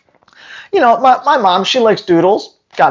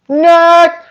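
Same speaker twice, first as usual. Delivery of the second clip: scoffing dismissal; 8 semitones higher than usual. Real, not acted. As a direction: wave it away again, higher. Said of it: "dismissing breeders".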